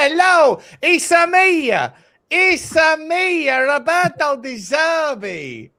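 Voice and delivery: high, slow, hammed-up Italian voice "It's a me"; loud mock Italian manager accent; generic Italian accent. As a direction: stretch it out like a cartoon Italian. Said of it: "a generic Italian accent".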